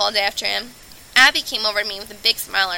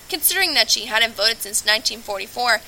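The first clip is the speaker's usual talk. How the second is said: ordinary delivery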